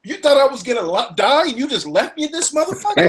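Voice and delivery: high, incredulous complaint; indignant disbelief. Retold or imitated. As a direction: act baffled and betrayed. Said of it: "I'm looking at them like".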